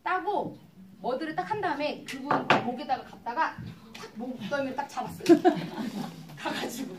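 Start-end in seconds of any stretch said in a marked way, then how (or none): 5.3-6.3 loud laugh. Real, not acted. Laughing at own story. (이야기하다 터진 큰 웃음)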